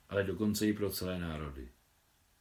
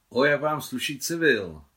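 loud, higher admiring exclamation; exclaimed; 5 semitones higher, 9 dB louder than usual. A narrator's reading. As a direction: call out a compliment from the doorway. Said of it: "vykřikla od prahu Nicole".

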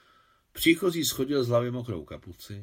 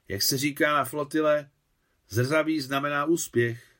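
merry, higher-pitched and louder; jolly raised voice; cheerful; 5 semitones higher, 5 dB louder than usual. A narrator's reading. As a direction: share a saying in high good humour. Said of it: "řekla veselá Anna Karpovna".

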